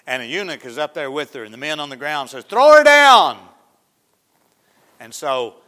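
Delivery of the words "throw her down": shouting. Acted. Men below shout to eunuch.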